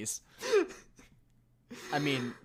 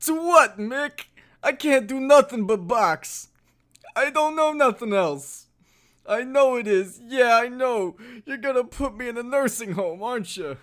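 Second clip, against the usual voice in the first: put-on high, loud old boxer voice; proud aging boxer, mumbling; Stallone impression; 7 semitones higher, 5 dB louder than usual. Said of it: "who has the better Stallone impression".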